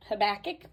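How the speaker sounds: Habakkuk as huh-BACK-ick, stress in the middle; alternative pronunciation. Shown as a demonstration. Vlogger trying variants of the book's name, stressed BACK. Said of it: "however you want to say it".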